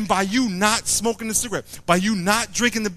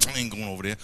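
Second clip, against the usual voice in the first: low, softer sulky refusal; stubborn refusal; 11 semitones lower, 9 dB quieter, much faster than usual. Acted. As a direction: sulk and refuse quietly. Stubborn man refuses God.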